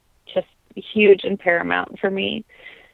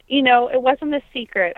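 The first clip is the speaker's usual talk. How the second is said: usual speaking voice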